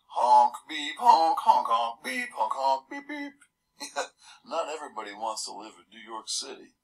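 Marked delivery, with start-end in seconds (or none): 0.1-3.3 much higher, louder imitated horn blasts; honking horns. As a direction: bleat out honk and beep like a traffic jam. Imitating many car horns honking.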